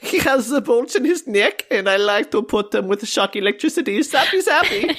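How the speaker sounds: put-on gleeful mad scientist, German accent; German accent building to a laugh. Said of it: "give us a good German accent".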